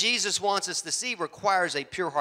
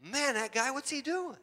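higher, pretending to be startled; mock alarmed driver; 9 semitones higher, 7 dB quieter than usual. Preacher pretending another car threatens them, mock alarm. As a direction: play the prankster faking alarm.

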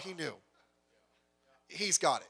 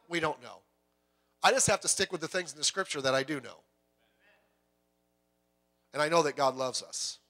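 usual speaking voice

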